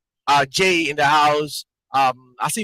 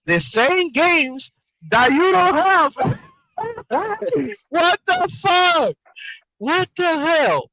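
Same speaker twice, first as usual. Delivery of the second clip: high laughing shout; laughing disbelief; 11 semitones higher, slower than usual. Real, not acted. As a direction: crack up in disbelief. Laughing at the rap diss.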